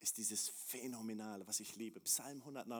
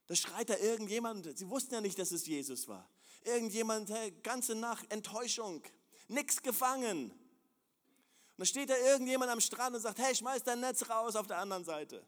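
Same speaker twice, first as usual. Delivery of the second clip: high, loud, driving sermon climb; fervent preacher voice, high and loud; preaching at full force; 11 semitones higher, 7 dB louder than usual. Acted. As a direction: preach it out to the whole hall, building. Retelling the disciples at the lake.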